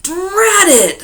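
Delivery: high, loud angry outburst; thundering curse. Acted. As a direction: explode at the rain. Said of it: "thundered Mister Wagon Wheel".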